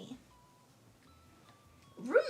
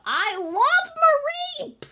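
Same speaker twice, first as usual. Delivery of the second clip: gushing; 13 semitones higher, 8 dB louder than usual. Real, not acted. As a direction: swoon over it. Gushing about favourite.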